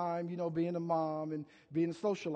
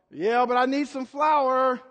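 mom repeats it, louder and slow; insistent mom; 4 semitones higher, 7 dB louder, slower than usual. Retold or imitated. Mother insisting to her son, slow and louder. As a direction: press the point like a mother.

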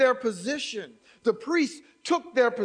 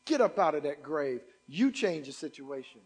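much lower and softer; quiet stern God; 8 semitones lower, 6 dB quieter than usual. Acted. God quietly rebuking complainers, low and stern. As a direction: say it low and firm, almost under the breath. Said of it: "God says".

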